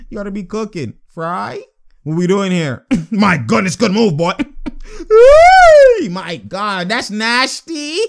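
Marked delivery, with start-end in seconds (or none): 4.4-6.1 high shrieking laugh; loud high laugh. Real, not acted. Reacting to a nasty move, shrieking laugh. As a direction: crack up loudly at the move. Watching highlight, big reaction.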